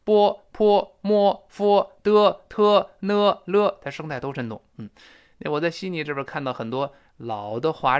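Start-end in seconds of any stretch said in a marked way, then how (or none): 0.0-3.8 loud, high, evenly spaced syllables; each syllable held on one high, level note; primary-school pinyin recital. Shown as a demonstration. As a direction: chant the initials like a classroom drill. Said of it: "你看像小学讲".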